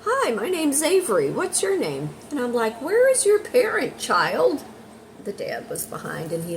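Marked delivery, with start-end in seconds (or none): 0.0-2.1 put-on high, loud sing-song child; little girl. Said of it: "One little girl came up to me". 2.8-4.6 very high, loud mock demand; hammed-up high-pitched questioning; mock alarm. Mock alarm at approaching child.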